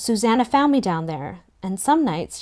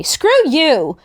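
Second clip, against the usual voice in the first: higher, louder playful retort; 7 semitones higher, 6 dB louder than usual. A narrator's reading. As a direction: fling it at his back. Belly splashes him, retorts.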